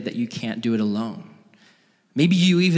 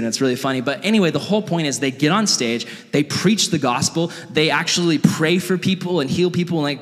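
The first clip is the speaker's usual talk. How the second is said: normal talking voice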